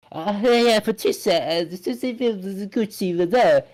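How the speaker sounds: high, hammed-up Italian accent like a cartoon Mario. Mocking Jared Leto's Gucci character in a high, hammy Italian accent. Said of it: "the way he talk is like".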